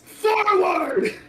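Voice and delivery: high, drawn-out commander's call; rally the troops with one big cry. Acted. Player leading a small army, high rallying shout.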